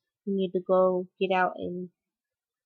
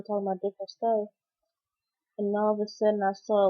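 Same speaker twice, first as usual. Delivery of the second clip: normal talking voice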